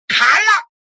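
loud, high command shout; barked order. Acted. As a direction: yell the order to behead. Ordering the execution. (厉声下令)

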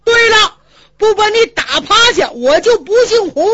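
high, loud vow to beat him; furious vow. Acted. Hu Dahai vowing to flatten the red-faced man, furious and loud. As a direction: swear it through the shouting.

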